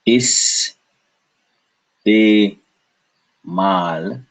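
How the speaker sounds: "Is, te, mal" as three separate beats; syllable by syllable. Shown as a demonstration. Teacher demonstrating three syllables, "Is, te, mal" in separate beats. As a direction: pause after "Is" and "te". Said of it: "Three syllables again".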